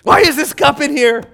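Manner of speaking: high, loud acted complaint; mock exasperation. Husband complaining about a dirty cup, mock exasperated. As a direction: raise the voice in comic irritation.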